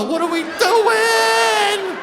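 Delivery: high, loud, stretched-out cry; overexcited boy. Retold or imitated. Thirteen-year-olds urging neighbor on, eager drawn-out mock shout. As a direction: shout it with the last word held long. Boys eagerly agree to the photos.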